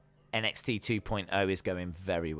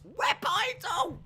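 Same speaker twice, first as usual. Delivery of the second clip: announcer shout; slower than usual. Pretend. Announcing new show title.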